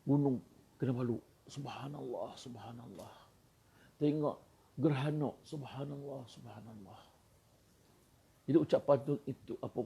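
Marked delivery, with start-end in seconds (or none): 1.5-3.1 hushed awe. Acted. Awe at Mount Kinabalu. 5.4-7.0 hushed awe. Acted. Awe at the eclipse.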